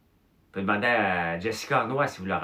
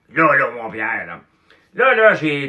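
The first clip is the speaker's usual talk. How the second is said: booming, much higher and louder; Claude Cloutier's big voice; 5 semitones higher, 12 dB louder, slower than usual. Retold or imitated. Claude Cloutier booming at Pierre over beer. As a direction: bellow it like a rowdy drinking buddy. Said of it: "il parlait avec une grosse voix".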